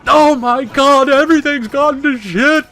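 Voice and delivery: slow, very high, very loud American melodrama; over-dramatic American. Shown as a demonstration. Gamer demonstrating American super drama, hammed-up wailing. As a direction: wail it out in hammed-up American panic. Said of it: "we make really good kind of, like".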